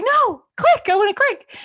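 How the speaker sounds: very high, loud tantrum; mock frustrated outburst. Reader argues with phone over buying, mock tantrum. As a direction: shout it in hammed-up impatience.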